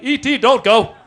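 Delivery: loud, high mock-tearful plea; hammed-up weepy begging; about to cry. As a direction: plead as if on the verge of tears. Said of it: "I was about to cry".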